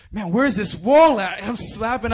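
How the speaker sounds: loud, slow desperate cry; high, loud frantic mock panic; panicked. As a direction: gasp it out while flailing in the water. Panicked swimmer can't find wall.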